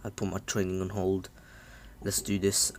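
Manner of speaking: quiet, understated resolve; mildly serious, voice dropped low; real serious. Retold or imitated. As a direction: say it softly, like a settled decision. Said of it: "Naruto is getting real serious now".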